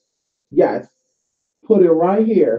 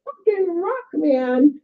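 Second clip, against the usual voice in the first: high, cracking yell of delight; excited cheer; 13 semitones higher, much slower than usual. Real, not acted. Cheering the people who joined the ritual, high and thrilled. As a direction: cheer them on at the top of your voice.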